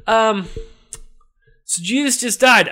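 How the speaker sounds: high, loud, hesitant report; nervous subdemon. Acted. Subdemon tells Satan the news, high and nervous. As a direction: break the news timidly upward.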